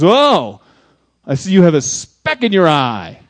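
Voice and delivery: loud, smug jeer; mock hypocrite. Hypocrite with a plank jeering at a sinner, loud smug mock. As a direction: sneer it out like a self-righteous hypocrite.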